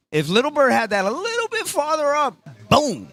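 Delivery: high-pitched, animated lament for a near miss; excited commentary. Real, not acted. Commentator imagining Little Bird's better lob, excited. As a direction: pitch it way up, then punch the last word.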